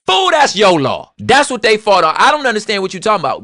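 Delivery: loud and high, calling them fools; exasperated scolding. Acted. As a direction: throw the law back in their face. Scolding the segregationists.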